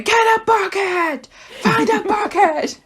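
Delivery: dad's urgent high yell, imitated; retold screaming. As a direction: holler like a dad mid-flood. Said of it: "my dad starts screaming at me".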